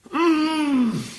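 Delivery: sudden high yell of shock; anguished yell. Acted. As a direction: let out a wild high cry. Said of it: "Ты чего орёшь".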